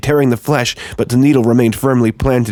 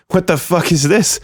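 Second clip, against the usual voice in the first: trembling voice; 5 semitones higher than usual. A narrator's reading. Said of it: "my voice trembling".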